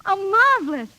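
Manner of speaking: high, loud burst of delight; thrilled. Acted. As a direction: exclaim it with joy. Pupil thrilled by his praise.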